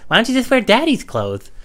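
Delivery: high-pitched mock mother voice; exaggerated high mom suggestion; mom impression voice. Mom's suggestion acted out.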